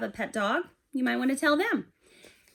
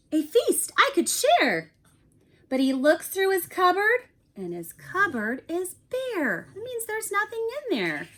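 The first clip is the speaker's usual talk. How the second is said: lively, higher storybook voice, a bit louder; animated picture book read-aloud; reading to children; 5 semitones higher, 4 dB louder, slower than usual. A narrator's reading. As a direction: read it like bedtime story time for a class. Teacher reads picture book aloud.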